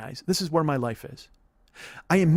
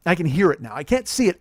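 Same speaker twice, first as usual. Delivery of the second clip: ordinary talk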